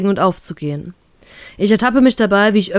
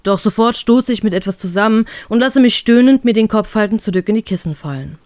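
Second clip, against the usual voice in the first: ordinary delivery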